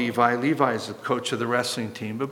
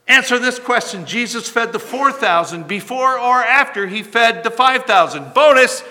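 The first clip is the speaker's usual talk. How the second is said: high, loud mock quiz master demanding an answer; 9 semitones higher, 11 dB louder than usual. Preacher mocking Jesus as a quiz master, loud. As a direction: bark the fill-in-the-blank like a game show. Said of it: "Jesus does not say".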